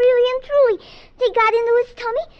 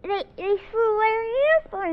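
words muffled by a mouthful; mouth full. Acted. Child repeating for mother, mouth full of cookie. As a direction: say it through chewed cookie. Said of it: "I can't understand a word you say with your mouth full".

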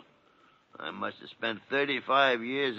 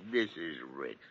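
laughing; 4 semitones lower, 4 dB quieter than usual. Acted. Helpless laughter.

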